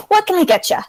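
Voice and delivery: high, cheerful barmaid; chirpy tavern server greeting; bartender voice. Acted. Bartender elf greets customer.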